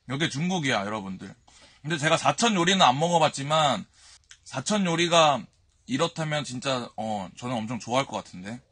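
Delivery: speaking between bites; talking while chewing. Real, not acted. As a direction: mouth partly full. Seen 1: food taken in just before; cheeks look full while talking.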